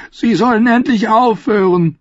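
very high, loud desperate plea; high, frantic wail; distressed cry. Acted. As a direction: cry out as if tormented. Tormented by dream voices, crying out.

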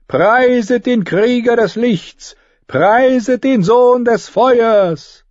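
high, loud, fervent priestess cry; ecstatic proclamation. Acted. Priestess proclaiming the reborn hero to the host, ecstatic cry. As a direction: proclaim the sign, exultant.

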